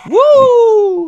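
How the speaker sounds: high loud whoop; excited whoop. Real, not acted. Cheering exit.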